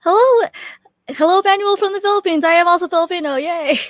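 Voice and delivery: high, loud, delighted hello; excited greeting. Real, not acted. Host greets a fellow Filipino from the Philippines, excited. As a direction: cheer a real, high-pitched welcome.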